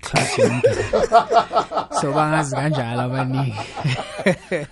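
chuckling through the words; laughing. Real, not acted. Artist telling the host about drawing in class, laughing. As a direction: laugh as you confess it.

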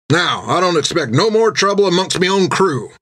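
high captain's voice, settling the matter; captain's final word. Acted. Jack to his crew, a captain's final word. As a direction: close the subject for good. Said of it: "with an air of finality".

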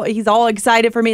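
usual speaking voice

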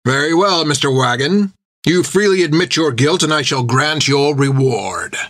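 a hint of a cold captain; stern captain. A narrator's reading.

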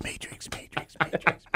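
whispered echo dying away; fading echo. Pretend. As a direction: echo it into silence. Echoing Matrix.

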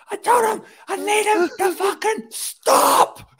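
hammed-up rage breaking into a laugh; squealing exaggerated fury; mock furious shriek. Retelling the boss losing his cool.

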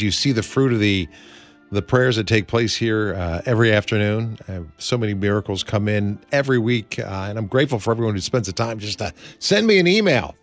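normal talking voice

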